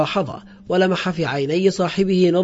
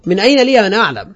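loud, fast indignant question; sharp outcry; 3 semitones higher, 6 dB louder, faster than usual. A narrator's reading. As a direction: raise your voice sharply in protest. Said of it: "فصرخ بحدة". (صراخ بحدة)

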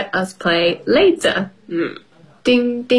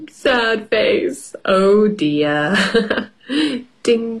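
usual speaking voice

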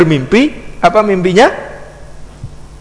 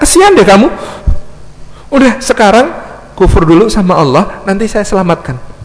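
urgent coaxing; 7 semitones higher than usual. Retold or imitated. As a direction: rush him into giving in. Devil urging Abid to renounce God.

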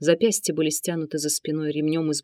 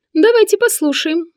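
high, loud sneering eunuch; 11 semitones higher, 6 dB louder than usual. A narrator's reading. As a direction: squeal it out mockingly. Sinan speaks.

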